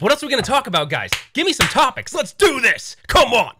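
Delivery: high-pitched hyped-up rallying; pumped. Real, not acted. Streamer on caffeine begging for topics, wildly pumped. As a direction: whip the chat up, climbing to a screech. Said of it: "I am feeling pumped".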